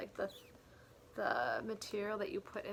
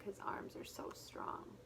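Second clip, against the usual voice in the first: whisper; 12 dB quieter than usual. Acted.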